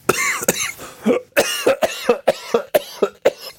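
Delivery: hard coughing fit from choking. Real, not acted. Chokes while reading.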